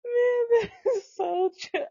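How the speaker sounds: can't hold the laugh in; real laughing outburst; laughing hard. Real, not acted. Cracking up at the story.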